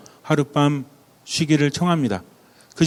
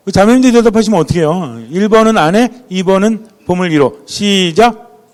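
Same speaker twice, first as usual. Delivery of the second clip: raised, much higher mock protest; loud audience prompting; 5 semitones higher, 8 dB louder than usual. Real, not acted. Preacher chides women for answering for the men, loud playful prompting. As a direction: call out the choices and cue the crowd.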